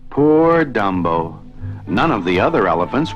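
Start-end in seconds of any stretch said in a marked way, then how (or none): none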